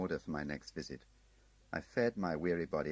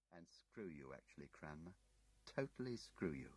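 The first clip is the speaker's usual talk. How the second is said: much softer, menacing undertone; hushed threat; 12 dB quieter than usual. Acted. Munslow threatening Tim quietly, hushed menace.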